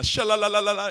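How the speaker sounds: high, loud sing-song babble of tongues; mock tongues chant in the background of a prayer; imitating tongues. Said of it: "the guy in the back of the room was".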